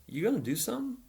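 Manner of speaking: put-on a touch of panic, pleading with the older boy; kinda panicked. Mickey urging Bobby to step in, mildly panicked. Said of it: "kinda panicked".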